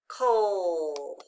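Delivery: "coal" drawn out low, falling; long "coal" sinking in pitch; isolated final syllable. Shown as a demonstration. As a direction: stretch "coal" as one lone syllable. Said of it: "the final stable syllable".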